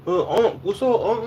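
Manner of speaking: put-on high teasing kid voice calling him unc; squeaky youngster voice; young kids' voice. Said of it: "They be like".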